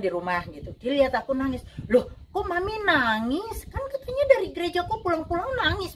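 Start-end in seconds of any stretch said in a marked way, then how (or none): 1.9-5.9 lightly voiced daughter, higher and puzzled; a hint of a surprised child asking why. Daughter asks her crying mother why, lightly voiced surprise.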